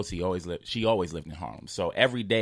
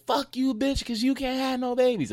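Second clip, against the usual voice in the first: much higher, furious insult; angry cussing woman. Retold or imitated. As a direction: spit the insult like a mad woman. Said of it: "and then she was like".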